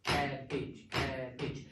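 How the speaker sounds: Cabbage beaten out as CAB-bage; drilling cabbage in two beats, first one strong; clapping out syllables. Shown as a demonstration. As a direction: tap each part of cabbage with the hand. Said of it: "make students do it with their hand".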